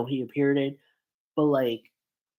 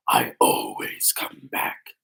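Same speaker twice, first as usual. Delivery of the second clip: much lower, slow villain catchphrase voice; William Afton; 14 semitones lower, slower than usual. Acted. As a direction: attempt an iconic game villain, drawn out and deep. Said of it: "William Afton's voice with the accent".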